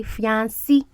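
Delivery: fiancé said fee-AN-see, ending in SEE; childhood pronunciation. Shown as a demonstration. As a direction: say fiancé with a final "see" sound. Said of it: "when I was growing up, we say".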